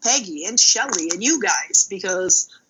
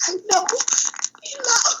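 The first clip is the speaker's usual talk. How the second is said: higher, lightly voiced little boy; small child; 14 semitones higher than usual. A kindergartner reassuring his teacher, high. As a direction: pipe it up like a kid.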